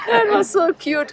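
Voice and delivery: said high through real laughter; remembering stepson's words, laughing. Real, not acted. Laughing while commenting.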